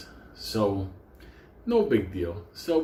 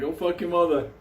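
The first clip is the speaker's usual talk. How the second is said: mock heckle; 7 semitones higher than usual.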